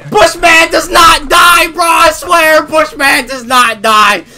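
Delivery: slow, high shouted proclamation; shouting in disbelief at the enemy; hyped shout. Real, not acted. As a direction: bellow it like a legend chant. Hyped about enemy sniper.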